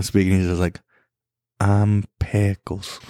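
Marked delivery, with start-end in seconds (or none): 1.6-3.0 very slow, lower southern drawl for the dog. Pretend. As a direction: drag it out like a lazy southern dog talking. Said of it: "the same accent".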